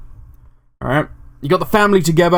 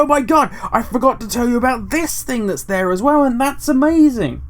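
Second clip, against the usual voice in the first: much higher breathless oh my god; mock excited; 9 semitones higher than usual. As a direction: blurt it out as if you just remembered. Waking up excited at night.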